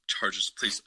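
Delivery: breathy hushed begging, Chargers please; whispered plea. Real, not acted. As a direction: whisper a prayer for the team. Fan praying his team wins.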